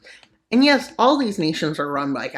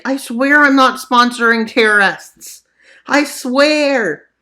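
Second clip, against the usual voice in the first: pleading Turkey; 8 semitones higher, 7 dB louder, slower than usual. Pretend. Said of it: "And Turkey's like".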